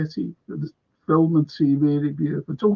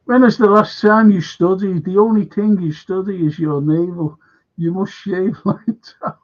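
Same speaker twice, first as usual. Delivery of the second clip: louder, higher mock taunt; quoted jibe; 3 semitones higher, 6 dB louder than usual. Quoting a jibe about studying, loud mock taunt. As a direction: read the taunt like a jeering teacher.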